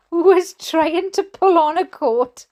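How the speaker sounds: usual speaking voice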